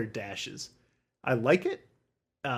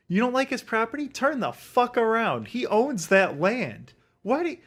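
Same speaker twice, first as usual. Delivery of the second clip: raised, higher-pitched rant at the boomers; heated rant at the boomer neighbors, raised and high; angry rant; 8 semitones higher than usual. Real, not acted. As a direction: tell the neighbors off, heated and fast.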